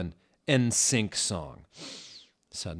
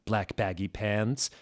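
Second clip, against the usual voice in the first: ordinary delivery, nothing marked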